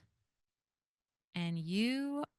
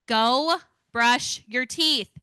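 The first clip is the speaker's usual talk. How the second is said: slow, loud, drawn-out command; stern parent yell; 3 semitones higher, 7 dB louder, much slower than usual. Shown as a demonstration. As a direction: bark it like a fed-up parent. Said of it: "I am going to use strong tone and I'm going to yell".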